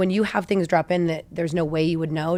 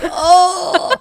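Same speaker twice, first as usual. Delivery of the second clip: high, loud "oh" bursting into laughter; mock-wounded laughing cry; 13 semitones higher, 11 dB louder than usual. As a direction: react as if playfully stung, cracking up. Laughing reaction to son's blunt verdict.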